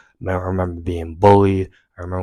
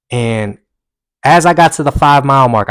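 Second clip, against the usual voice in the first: ordinary delivery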